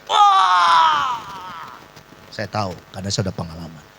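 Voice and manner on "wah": long, loud, high scream; drawn-out high re-enacted shriek; imitated scream. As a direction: wail as the demon leaves. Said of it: "dia teriak".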